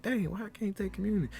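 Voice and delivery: put-on high, whiny little-boy voice; imitating his young self pleading; childish plea. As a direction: beg like a kid denied a treat. Boy asking to take communion.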